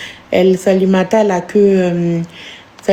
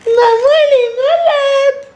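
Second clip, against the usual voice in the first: put-on child voice; 10 semitones higher than usual. Mother voicing her child.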